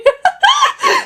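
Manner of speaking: very high burst of laughter; shrieking laugh. Real, not acted. Telling of being captured to 4D, high shrieking laugh. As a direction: squeal a sudden high laugh.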